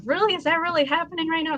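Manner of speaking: high-pitched retold disbelief; amazed, voice jumping up; incredulous. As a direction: question it as if it can't be real. Acting out disbelief at big tips.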